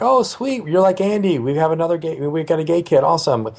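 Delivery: high, gushing mock celebration; imagined delighted reaction. Acted imagined cheerful reaction of parents.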